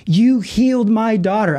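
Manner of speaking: high, slow, imitated outcry; tearful mother. Mother in tears confronting the teacher, imitated high outcry. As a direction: imitate an overwhelmed mom, pitched well up. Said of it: "She comes out of the classroom in tears".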